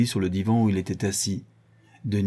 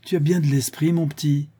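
miffed sarcastic compliment, raised pitch; vexed; 7 semitones higher, 5 dB louder than usual. A narrator's reading. Vexed Jean turns on Pierrot, sarcastic. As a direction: throw it back, stung. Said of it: "Jean, l'air vexé".

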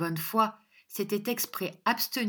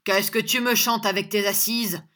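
raised, dismissive challenge; scornful cry, higher pitched; loud scoffing; 4 semitones higher, 7 dB louder than usual. A narrator's reading. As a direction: brush off the threat forcefully. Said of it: "cria John".

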